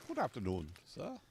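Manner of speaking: mimicked English butler-like greeting, swooping pitch; playful mock English accent. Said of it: "how to do an English accent".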